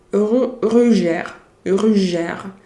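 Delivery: "Rougeur" with a French throaty R, slowed down; French word said in French. Shown as a demonstration. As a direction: say "Rougeur" the French way, like a beauty brand. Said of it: "It's like a French, uh, beauty product".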